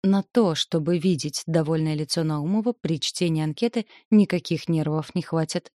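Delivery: ordinary delivery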